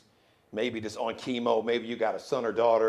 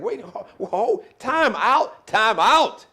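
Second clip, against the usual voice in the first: high, loud startled protest; mock outrage; 10 semitones higher, 8 dB louder, slower than usual. Man hit with a huge bill, shocked and protesting. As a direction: cry foul like a shocked customer.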